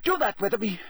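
high, strained voice of a man gone mad; addled ministry boss giving orders to a tree; deranged official. A narrator's reading. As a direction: dictate orders to no one, unhinged. Crouch rambling orders.

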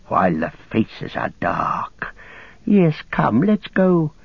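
high, slow, wheedling creature; fawning, eager little creature; Gollum's creature voice. Acted. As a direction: squeal and coax, drawing words out. Gollum fawns and urges departure.

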